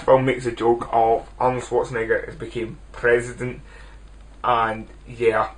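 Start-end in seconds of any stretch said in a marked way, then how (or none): none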